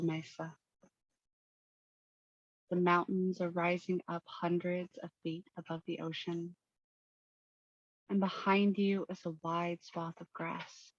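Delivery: soft, even meditation voice with long pauses; unhurried, hushed visualization narration; guided meditation. Acted. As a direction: guide listeners gently into calm, leaving space between phrases.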